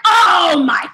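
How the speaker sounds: hammed-up disgusted friend, loud outbursts; Nick. Imitating best friend appalled by naked interview idea, hammed-up disgust. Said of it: "Nick was like".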